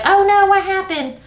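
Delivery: high-pitched retold "oh no"; mock concern. Coworker hearing about the office drama, high-pitched reaction. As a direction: pitch it way up, imitating a curious coworker.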